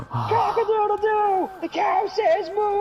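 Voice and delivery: very high, loud, drawn-out crowing; deranged farmyard yelling, hammed up; Crazy Steve. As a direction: crow and moo at full manic pitch. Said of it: "Steve goes especially crazy".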